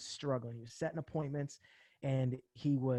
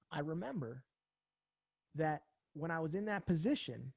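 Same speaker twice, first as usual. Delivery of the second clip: usual speaking voice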